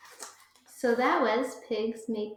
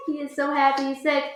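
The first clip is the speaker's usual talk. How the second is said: usual speaking voice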